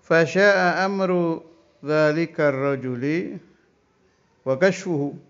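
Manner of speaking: kitab recitation. Acted. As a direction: intone the Arabic text like a pesantren teacher.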